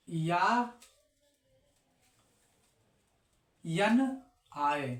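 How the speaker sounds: slow dictation. Shown as a demonstration. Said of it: "slow dictation of the rule".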